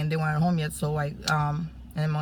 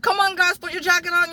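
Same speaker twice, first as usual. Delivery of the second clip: put-on much higher, raised call to the children; hurrying the kids; 12 semitones higher, 4 dB louder than usual. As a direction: rush the kids into jackets. Rushing kids out at night.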